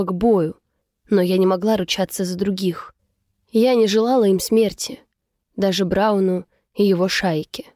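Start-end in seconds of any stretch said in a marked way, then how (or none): none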